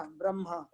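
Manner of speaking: m first, then h, in ब्रह्मा; wrong pronunciation. Shown as a demonstration. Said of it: "तो ये गलत है".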